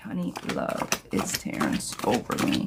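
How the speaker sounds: breathy whispered reread of the question. Shown as a demonstration. Tarot reader whispering a viewer's question back. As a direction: whisper it low and secret.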